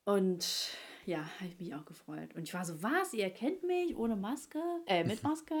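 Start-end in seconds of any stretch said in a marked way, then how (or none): 2.8-5.6 surprised. Retold or imitated. Retelling her surprised reaction.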